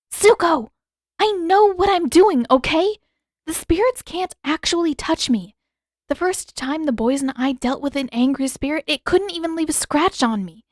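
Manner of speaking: put-on young heroine voice, heated; Katara, insistent. A narrator's reading. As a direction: argue urgently with Zuko.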